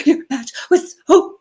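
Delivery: high, wobbly, tearful voice; teary retelling. Retold or imitated. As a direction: let it crack like you're choking up on the phone. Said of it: "I was just bawling".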